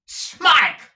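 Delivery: very high, very loud shout. Acted. Squeers shouting for the runaway boy up the dormitory stairs, full yell. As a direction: yell the name at full force. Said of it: "shouted Squeers".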